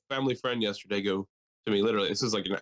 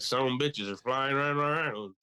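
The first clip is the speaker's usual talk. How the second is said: slow, put-on rural accent; mock rural accent; 3 semitones higher, slower than usual. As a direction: drag it out in an imitated local accent. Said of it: "why they have that accent".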